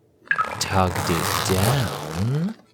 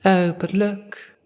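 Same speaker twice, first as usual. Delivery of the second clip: high, slow, lilting aside; Cassandra noticing; 10 semitones higher, slower than usual. Acted. Cassandra points past Chip to the frame. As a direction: draw the eye with a high purr.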